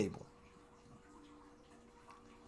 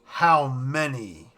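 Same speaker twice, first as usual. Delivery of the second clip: slow, louder, dragged-out "How many?"; stunned, drawn-out repeat; 2 semitones lower, 5 dB louder, much slower than usual. Retold or imitated. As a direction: stretch "How many?" out slowly, in disbelief. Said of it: "Cyclops is just like".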